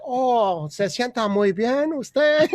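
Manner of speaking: slow Spanish in a mock Chinese accent. Waiter greets a customer who walked in, mock Chinese accent. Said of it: "Is that Spanish with a Chinese accent".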